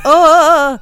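loud, high, hammed-up Scottish brogue; Scottish accent. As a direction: play an old Scotsman, loud and high. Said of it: "That's Scottish".

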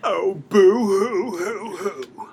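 slow, loud mock sobbing; hammed-up boo hoo sob; fake crying. Mocking ruined prom dress.

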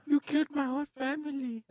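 very high put-on female character voice; Scarlet Witch. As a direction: squeak it out as an impression of a wronged heroine. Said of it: "That was Scarlet Witch".

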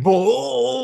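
high startled yelp; a big shocked gasp of whoa. Retold or imitated. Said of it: "they were like".

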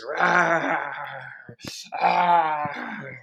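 playful held roars; drawn-out mock roars; bear roar. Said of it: "You're like a bear".

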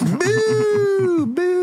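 high, drawn-out jeer; playful crowd heckle; mock booing.

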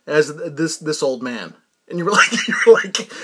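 normal talking voice

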